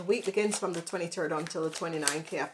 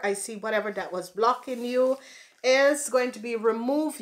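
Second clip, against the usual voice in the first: ordinary talk, nothing marked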